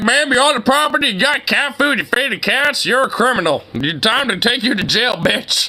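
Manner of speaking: high, fast mock cop voice; hammed-up cop lecturing an old lady. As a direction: parody the officer as a pompous fool. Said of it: "It's like".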